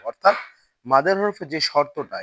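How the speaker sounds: ordinary talk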